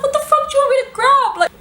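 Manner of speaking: very high, loud re-enacted squeal; panicked retort. Answering friend's order to grab something, shrieking panic. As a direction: shriek it in mock hysteria, laughter close behind.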